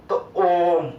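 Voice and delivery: ओम held long like a chant; prolonged chanted ओम; drawn-out ओम. Shown as a demonstration. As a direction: hum the ओम out long. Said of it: "तो जो आप इस तरीके से आप बोल रहे हो".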